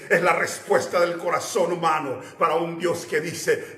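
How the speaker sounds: usual speaking voice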